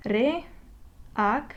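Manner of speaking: "Reacciones" in separate syllables, long gaps between them. Shown as a demonstration. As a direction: break "Reacciones" up as Re, ac, cio, nes. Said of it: "divide this word into syllables".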